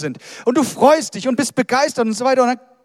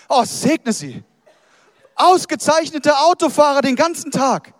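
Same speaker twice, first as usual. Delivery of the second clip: hammed-up gushing, higher and louder; mock driver praise; 5 semitones higher, 3 dB louder than usual. Preacher mocks drivers he never praises, hammed-up gushing. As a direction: gush over the other drivers as a joke. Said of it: "und sagen".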